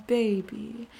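usual speaking voice

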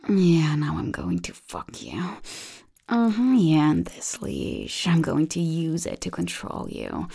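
ordinary delivery

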